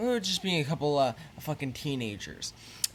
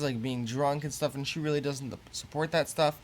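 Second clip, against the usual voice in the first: usual speaking voice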